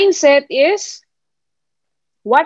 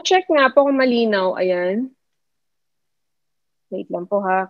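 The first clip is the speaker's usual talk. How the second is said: normal talking voice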